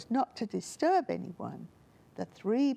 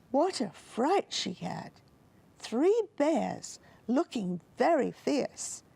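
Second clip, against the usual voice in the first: ordinary delivery, nothing marked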